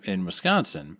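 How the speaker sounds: usual speaking voice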